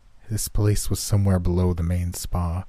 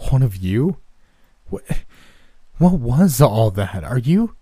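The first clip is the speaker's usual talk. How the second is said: loud then suddenly hushed; 4 semitones higher, 6 dB louder than usual. Acted. Panicked questions after cult ritual.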